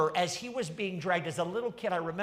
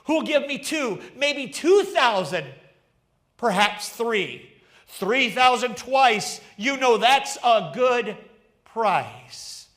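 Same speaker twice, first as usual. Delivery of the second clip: higher, louder auctioneer's rising bids; auctioneer crying out; 4 semitones higher, 8 dB louder than usual. Acted. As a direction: rattle off the bids to the crowd. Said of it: "And then he cried out".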